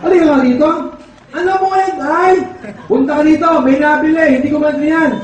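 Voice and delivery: mock father calling out; calling his child. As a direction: shout for the kid to come. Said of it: "tinawag yung anak".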